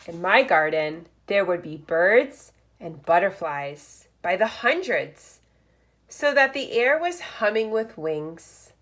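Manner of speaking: lively picture-book read-aloud; reading to children. Acted. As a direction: read it like story time for first graders. Reads picture book aloud to class.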